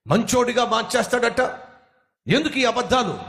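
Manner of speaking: high, furious tirade at the preacher; angry shouting. Acted. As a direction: yell him off the street, pitch way up. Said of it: "గట్టి గట్టిగా అరిచేస్తున్నాడు".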